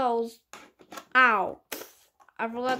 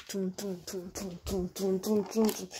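low, soft repeated engine putts; tiny car noise; 4 semitones lower, 6 dB quieter than usual. Pretend. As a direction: imitate a little car puttering along. Imitates a tiny Fiat putt-putting.

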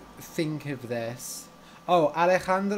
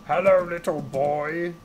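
louder, put-on dragon greeting; 2 semitones higher, 6 dB louder than usual. Dragon Alejandro greeting the boy in a put-on voice. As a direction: loom over the little boy as the dragon. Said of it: "Doing Alejandro's voice kind of hurts my throat".

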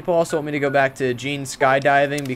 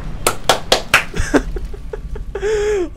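relieved laugh. Real, not acted. Relief after fight.

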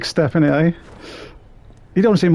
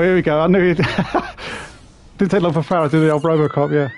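ordinary talk, nothing marked

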